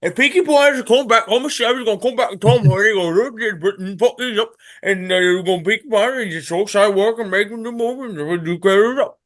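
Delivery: put-on loud, high, garbled Peaky Blinders accent. Co-host imitating the show on request, garbled Peaky Blinders accent. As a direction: rant unintelligibly in a hammed-up Peaky Blinders accent. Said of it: "Give me a Peaky Blinders accent".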